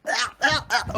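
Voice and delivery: sharp startled cries; real fright in a dark room; frightened gasps. Real, not acted. Streamer gasping in fear alone in a morgue.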